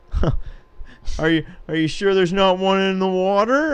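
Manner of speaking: high, puzzled tourist. Retold or imitated. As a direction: ask like a confused tourist. Tourists questioning the sign.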